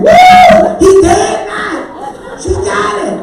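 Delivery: high loud mock whoop, dragged out slow; cheering. Wife cheering at the movie's revenge scene loudly. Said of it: "sitting there cheering".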